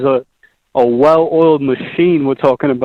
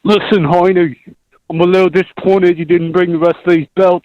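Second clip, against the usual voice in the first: put-on Australian fighter talk; Australian accent impression; 3 semitones higher than usual. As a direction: taunt a rival in a broad Australian accent. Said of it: "that's a good Australian impression right there".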